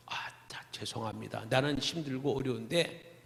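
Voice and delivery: hushed, tired plea under the breath; a soft, worn-out excuse to God; weary murmured prayer. Retold or imitated. Pastor quietly excusing himself to the Lord, tired.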